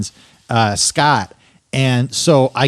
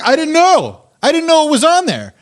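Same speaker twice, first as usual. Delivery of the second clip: very high, squealed denial; retold frantic protest; 12 semitones higher than usual. Husband defending himself to upset wife, high retold protest. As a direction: plead innocence in a high, hammed-up voice.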